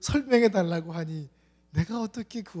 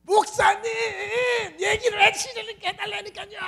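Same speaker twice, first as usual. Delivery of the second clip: high, loud yelling youth; shouting young man; 7 dB louder than usual. Retold or imitated. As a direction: yell it in a high pitch. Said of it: "얘가 막 고성을 지르는 거예요".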